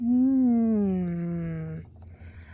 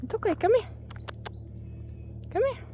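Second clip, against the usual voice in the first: high, softer gentle come-here; soft coaxing pet call; 13 semitones higher, 9 dB quieter, faster than usual. Real, not acted. Fisherman gently coaxing the heron back, high soft pet voice. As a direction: gently coax the bird closer.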